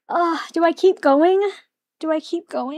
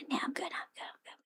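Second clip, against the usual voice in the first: each repeat drops softer until it's a bare whisper; fading to a whisper; 20 dB quieter, faster than usual. Real, not acted. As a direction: let the words trail off into a whisper. Declining a snack, fading out.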